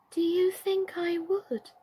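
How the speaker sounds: high, slow and meek; humble Birdie. A narrator's reading. Doll Birdie humbly asking Emily, high and slow. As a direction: ask it humbly, small and unsure. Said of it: "asked Birdie humbly".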